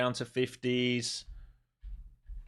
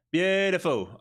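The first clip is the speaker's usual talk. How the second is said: delighted; 10 semitones higher, 6 dB louder, slower than usual. Real, not acted. Trade hits target, delighted.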